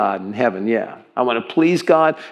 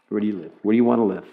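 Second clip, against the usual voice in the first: ordinary talk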